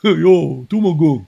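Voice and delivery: playful mock New York accent. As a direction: toss it out as a joke New Yorker. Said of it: "got the New York pronunciation".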